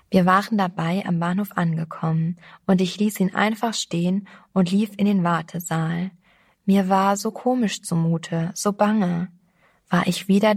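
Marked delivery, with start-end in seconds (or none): none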